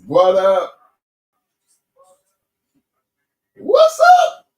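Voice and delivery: loud hype-man greeting, building to a shout. Acted.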